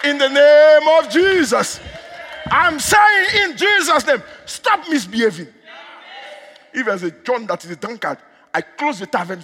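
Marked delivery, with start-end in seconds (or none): 0.1-5.5 high, loud commanding shout; full-force preaching. Real, not acted. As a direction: rebuke in a raised pulpit voice.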